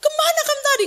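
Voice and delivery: high, demanding father's question; acted-out parental interrogation; imitated angry questioning. Acts out father repeating his demand.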